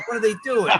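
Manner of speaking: high, loud baffled cry; surprised. Retold or imitated. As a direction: gawk at it in disbelief. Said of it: "People be like".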